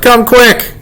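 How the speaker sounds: high-pitched call for help; urgent holler. A narrator's reading. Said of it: "I hollered".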